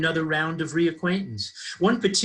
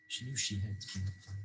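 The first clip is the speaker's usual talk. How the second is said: much softer, trailing afterthought; hushed repeat; 5 semitones lower, 14 dB quieter, faster than usual. A narrator's reading. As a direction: drop to a near-murmur.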